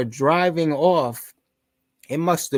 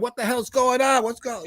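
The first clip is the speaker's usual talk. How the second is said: confused shouting; 10 semitones higher than usual. Retold or imitated. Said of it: "everybody's screaming".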